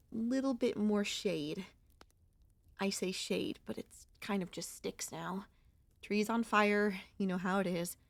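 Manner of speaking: normal talking voice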